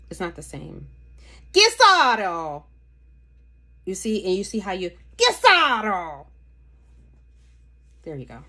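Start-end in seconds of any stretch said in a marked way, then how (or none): 1.5-2.6 "Chiaroscuro" belted out loud, full Italian gusto; "Chiaroscuro" shouted out in a big Italian voice. Shown as a demonstration. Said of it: "do you have to scream". 5.2-6.3 "Chiaroscuro" belted out loud, full Italian gusto; "Chiaroscuro" shouted out in a big Italian voice. Shown as a demonstration. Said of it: "do you have to scream".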